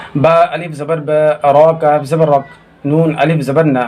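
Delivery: usual speaking voice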